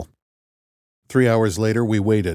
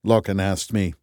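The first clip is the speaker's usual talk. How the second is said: plain reading voice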